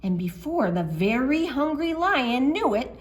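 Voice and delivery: ordinary delivery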